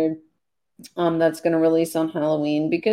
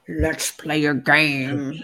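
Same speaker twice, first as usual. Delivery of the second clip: creepy Saw voice; 3 semitones lower, 3 dB quieter, much slower than usual. Pretend. Horror movie quote.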